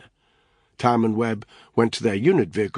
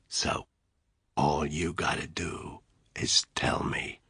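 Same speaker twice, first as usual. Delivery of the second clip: slow, menacing whisper; deathly quiet; 4 semitones lower, 7 dB quieter, slower than usual. Acted. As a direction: whisper it like a threat. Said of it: "in a deathly quiet voice".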